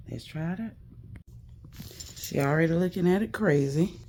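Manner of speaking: ordinary delivery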